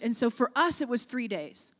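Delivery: ordinary delivery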